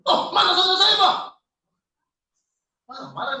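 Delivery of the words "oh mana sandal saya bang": imitating a man yelling for his sandals, louder and higher with a laugh; a churchgoer yelling about his lost sandals, mock shout. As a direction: play the frantic shout half in jest. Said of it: "Berteriak-teriak dia".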